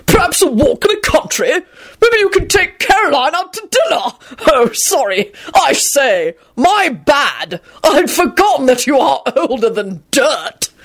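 put-on high, blustery old gentleman; hearty old boy. A narrator's reading. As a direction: needle the wife with mock-cheerful apology. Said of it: "Richard yelled out happily".

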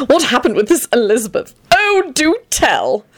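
high, eager prying; avid, high-pitched character voice. A narrator's reading. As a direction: beg for gossip, stretching the last words. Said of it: "Antonin piped up eagerly".

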